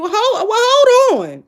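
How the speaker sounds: high, loud, drawn-out objection; indignant disbelief. Real, not acted. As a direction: cut in high and loud, stunned. Mock outrage at quoted remark.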